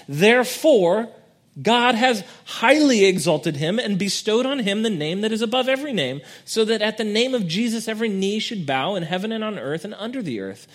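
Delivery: soaring sermon crescendo; preached proclamation. Real, not acted. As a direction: proclaim it to the whole church. Climactic scripture proclamation.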